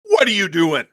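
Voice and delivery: loud, high challenge across the field; yell. Acted. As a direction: call out to strangers sharply. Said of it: "she's gonna yell".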